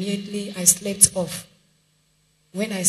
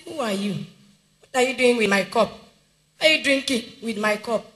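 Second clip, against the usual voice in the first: retold shout; 3 semitones higher, 6 dB louder than usual. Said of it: "I shouted".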